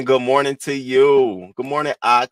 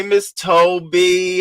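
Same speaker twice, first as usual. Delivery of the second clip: usual speaking voice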